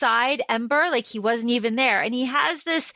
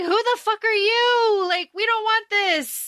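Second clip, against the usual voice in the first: high indignant protest; 10 semitones higher than usual. Retold or imitated. Host voicing the kids shouting at the Beast, high mock outrage. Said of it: "I say".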